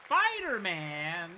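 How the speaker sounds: long, drawn-out loud shout of the title; excited announcement. Real, not acted. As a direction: call it out like a hype announcer.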